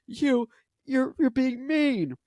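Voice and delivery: high, put-on whiny objection; high mocking protest; mock complainers. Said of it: "people who are gonna say".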